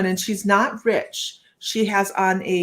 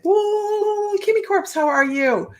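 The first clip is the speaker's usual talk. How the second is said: high, bright excited hello, much higher; excited greeting; 13 semitones higher, 3 dB louder, slower than usual. Real, not acted. Greeting a viewer by name.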